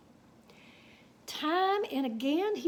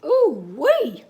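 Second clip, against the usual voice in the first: a loud, delighted whoop; excited whoop; 2 semitones higher, 11 dB louder than usual. Real, not acted. Teller whoops at the stampede, loud and delighted. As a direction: holler it like a cowhand at a stampede.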